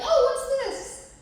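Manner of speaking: very high, slow mock curious voice; imitating curious people. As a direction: squeak it out like a nosy stranger. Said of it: "be just kind of like".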